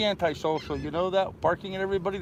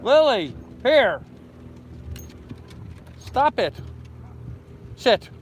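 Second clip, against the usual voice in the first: firm dog commands; 6 semitones higher, slower than usual. Real, not acted.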